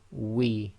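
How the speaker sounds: "We" with rounded lips; Arabic waw. Shown as a demonstration. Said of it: "make a round circle with your lips".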